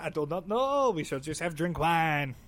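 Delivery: comic French soldier; French accent. Acted. Joking about French mafia warfare, mock French accent. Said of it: "it's the French mafia".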